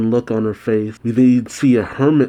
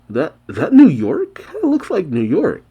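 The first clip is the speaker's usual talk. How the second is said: surprised; 6 semitones higher than usual. Real, not acted. Surprised guess at the setting.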